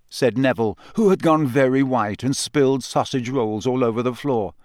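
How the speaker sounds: ordinary delivery, nothing marked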